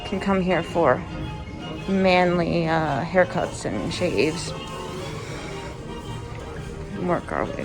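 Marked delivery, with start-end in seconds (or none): none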